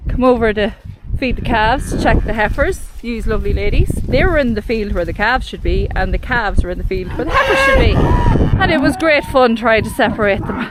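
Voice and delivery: ordinary delivery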